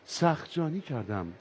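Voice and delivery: deep, slow recited verse from the car radio; radio reciting poetry. Retold or imitated. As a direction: read it like an old radio poet, low and slow. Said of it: "ماشین گفت".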